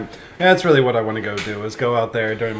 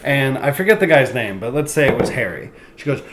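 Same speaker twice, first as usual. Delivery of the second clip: ordinary talk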